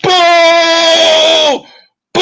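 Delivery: loud high booing crowd. Acted. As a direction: boo hard like an angry arena.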